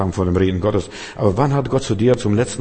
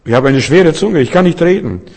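louder, higher whining excuse; pleading Moses; 7 semitones higher, 8 dB louder than usual. Acted. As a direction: whine the excuse up high. (jammernde Ausrede, lauter und höher)

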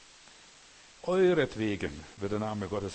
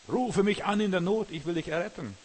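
crying out; 7 semitones higher, 4 dB louder than usual. Acted. Preacher crying out God's call.

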